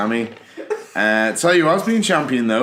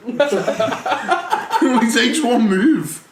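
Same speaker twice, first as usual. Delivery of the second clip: higher, slow words broken by laughter; laughing protest; 5 semitones higher, much slower than usual. Real, not acted. Fan worrying about a wrestler's neck, laughing protest. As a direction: protest through real laughter.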